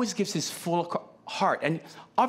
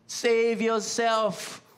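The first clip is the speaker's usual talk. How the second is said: slow mock taunt repeated; 4 semitones higher, much slower than usual. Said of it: "mocking words".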